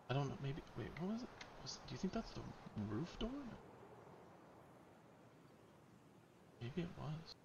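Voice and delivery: soft, hushed puzzling. Real, not acted. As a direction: keep it down, thinking aloud. Hushed wondering near enemies.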